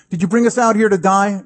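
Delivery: quick, high mock protest; complaining. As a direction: imitate an accusing, grumbling crowd. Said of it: "They complain".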